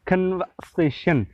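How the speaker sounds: slow कन्वर्सेशन, no R, breathy K; British conversation. Shown as a demonstration. Teacher slowly repeating the British कन्वर्सेशन for students. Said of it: "खे की आवाज़ निकालते हैं".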